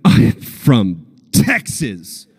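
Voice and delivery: very slow, self-assured quoted reply; slow cocky drawl. Retold or imitated. Actor bluffing to casting about riding, slow cocky. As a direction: stretch it out like a smug Texan. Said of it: "I said".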